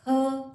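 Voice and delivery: her said as a lone, punched 'huh'; isolated h sound. Shown as a demonstration. Teacher giving learners the letter's sound, isolated h. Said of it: "और ह इसका sound है".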